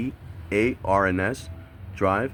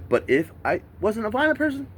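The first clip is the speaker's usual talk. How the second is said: worked up retelling; 11 semitones higher than usual. Retold or imitated. Retold plea to police.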